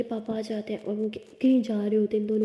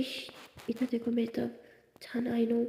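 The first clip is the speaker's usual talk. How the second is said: hushing; 10 dB quieter than usual. Acted. Siya hushes and calms Tara.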